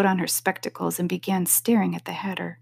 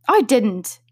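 hurried denial; 3 semitones higher, 4 dB louder than usual. A narrator's reading. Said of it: "interrupted in a great hurry".

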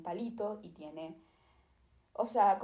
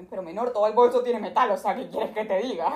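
exasperated protest; 8 dB louder than usual. Retold or imitated. Protest to security agent.

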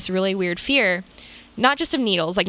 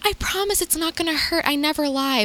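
wife reassuring; 8 semitones higher than usual. Retold or imitated. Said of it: "his wife was like".

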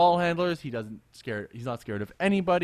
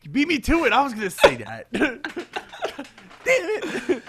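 higher and louder, breaking into laughter; laughing surprise; 10 semitones higher, 4 dB louder than usual. Real, not acted. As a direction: laugh it off, caught out. Caught out by co-host's answer.

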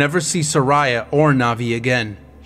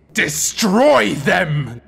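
slow, drawn-out demon command; 5 semitones higher, much slower than usual. Acted. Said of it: "the demon beckoned".

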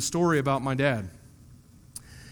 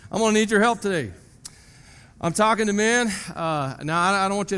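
loud gung-ho; 6 semitones higher, 5 dB louder than usual. Real, not acted. Rallying the men.

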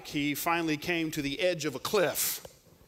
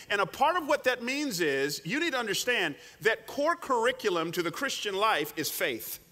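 ordinary talk, nothing marked

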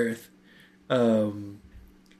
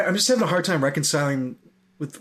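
ordinary talk, nothing marked

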